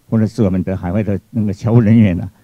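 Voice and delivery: normal talking voice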